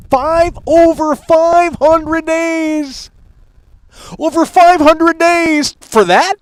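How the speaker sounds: high, slow drawn out disbelief; high slow incredulous whine. Real, not acted. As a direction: stretch it out, can't believe it. Disbelief at wait.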